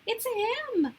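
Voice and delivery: a high, breathless burst; excited gasp. A narrator's reading. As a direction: gasp out the news in delight. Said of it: "Linus gasps".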